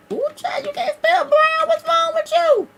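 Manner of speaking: very high, squeaky mock scolding; high-pitched teasing put-on voice; mock self-scolding. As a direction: playfully tell yourself off in a high falsetto. Teasing herself over spelling Brown.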